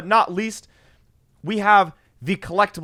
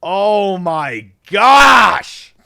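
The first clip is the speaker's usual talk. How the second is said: loud, stretched-out amazement; amazed outcry; 7 dB louder, much slower than usual. Real, not acted. Gamer admiring his finished car, loud amazement. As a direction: cry it out, drawing out the last word.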